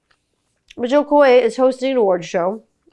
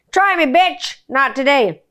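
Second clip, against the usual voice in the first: defiant challenge; 6 semitones higher, 5 dB louder, slower than usual. Real, not acted.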